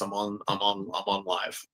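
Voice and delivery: quiet, low aside to someone off mic; hushed aside. Real, not acted. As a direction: drop your voice to tell them you're live.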